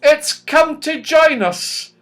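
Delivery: thrilled the clock found them; excited. A narrator's reading. Silky tells the others the clock came, high and excited. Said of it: "cried Silky excitedly".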